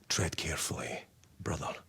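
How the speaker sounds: a secret caution between brothers; whispered warning. Acted. Fellow marine warning Titus in confidence, whisper.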